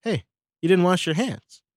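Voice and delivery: put-on high repeated hey, catching the kid; calling out kid. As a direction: stop the kid mid-step.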